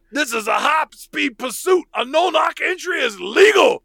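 high loud shouted announcement; robot cop shout. Retold or imitated. Host voicing Crime Buster crashing in, high loud shout. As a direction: bark it like a raiding robot cop.

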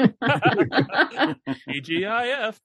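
laughing. Acted. Joking, drawn-out with a laugh.

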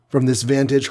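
usual speaking voice

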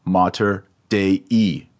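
Dei said slowly as two vowels, deh-ee; slow careful Mater Dei; both vowels in Dei. Shown as a demonstration. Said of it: "you have to say both vowels".

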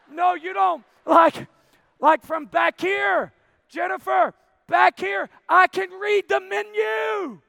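high, stretched-out insistent shouting; yelling. Retold or imitated. Husband to Jennifer from back of restaurant, yelling. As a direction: shout it slowly to get through to them. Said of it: "I'm yelling at the whole restaurant".

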